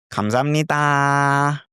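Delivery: cute, fast Korean "감사합니다"; too cute. Shown as a demonstration. Tutor warning men against cute "감사합니다", cute. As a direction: say "감사합니다" sweetly and quickly. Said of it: "might sound a little bit too cute".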